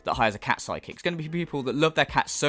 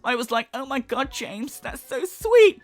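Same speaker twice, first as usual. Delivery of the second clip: high, swooning, stretched sweet; mock gushing psychic; 9 semitones higher, 4 dB louder than usual. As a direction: overplay the delighted psychic. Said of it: "She's acting like".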